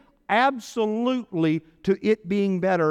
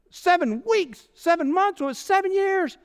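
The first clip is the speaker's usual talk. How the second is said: incredulous; 9 semitones higher than usual. Real, not acted. Preacher marvelling at seven years.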